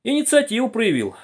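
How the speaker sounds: high, wry remark; amused sneer. A narrator's reading. Lieutenant comments on the boy's idea, wryly amused. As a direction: remark with a touch of mockery. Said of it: "усмехнулся лейтенант".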